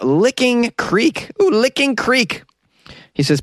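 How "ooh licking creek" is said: high, slow, suggestive echo; mock-titillated, drawn-out repeat; teasing ooh. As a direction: savor the rude-sounding name up high. Repeats rude-sounding name with an ooh.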